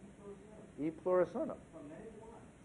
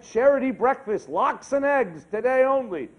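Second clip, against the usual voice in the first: billboard announcement; 5 semitones higher, 9 dB louder than usual. Acted. Reading billboard in joke.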